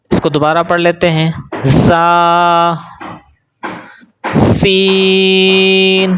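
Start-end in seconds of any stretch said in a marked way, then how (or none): 1.6-2.7 زا held long after a sharp buzzing z; drawn-out زا, z sharply sibilant; whistled z. Shown as a demonstration. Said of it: "یہ آپ نے زا میں نکالنی ہے". 4.4-6.2 سین stretched very long with a sharp s; drawn-out سین with a whistling start; whistled s. Shown as a demonstration. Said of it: "سیٹی کے ساتھ پڑھنا ہے".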